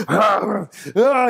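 mock roar. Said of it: "Nicholson's, like, taking the acid".

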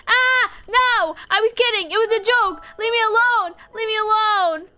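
high, loud playful panic; panicked yelling. Pretend. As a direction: scream for mercy while being chased. Game character chased, yelling.

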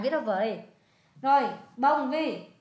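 ordinary talk

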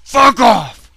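high, loud snapped yell; irritated shout. Real, not acted. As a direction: spit it at the enemy. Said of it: "I'm getting a little irritated".

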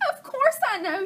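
high excited shout as the hen. Acted. Chicken Little snaps back at the detective, high shout. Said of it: "she shouted".

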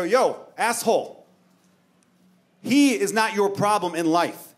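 slow, high, emphatic righteous address; heroic speech. Retold or imitated. Passenger confronting the man yelling slurs, grandly righteous. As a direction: stand up for him, spacing out each grand phrase. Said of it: "like, a Captain America style speech".

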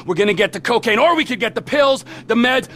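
normal talking voice

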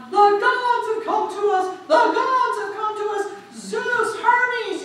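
high, loud cries of wonder; excited crowd shout. Acted. The town folk shouting about the gods, loud excited cries.

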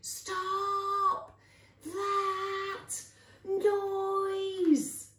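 high, stretched-out scream of command; top-of-voice yell. A narrator's reading. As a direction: yell each word as long as it will go. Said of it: "at the top of her voice she yelled".

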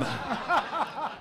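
una risa aguda que se le escapa de su propia ironía; a sudden high giggle at his own joke; high self-amused laugh. Real, not acted. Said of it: "I've amused myself there".

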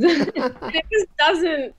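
breaking up with laughter mid-sentence; laughing. Real, not acted. Laughing at her own French caricature.

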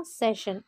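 सेशन with sh, "seshan"; correct pronunciation. Shown as a demonstration. Teacher modelling the right sound for students, careful sh. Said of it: "correct pronunciation होगा".

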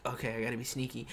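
low, soft and fast; sneaky aside. Retold or imitated. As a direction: recall a stealthy thought under your breath. Retells own sneaky thought.

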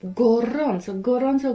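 usual speaking voice

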